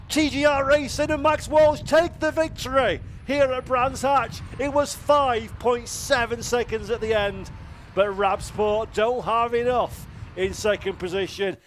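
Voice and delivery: high, loud celebratory commentary; excited shouting. Real, not acted. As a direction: call the winner with joy. Victory call after finish.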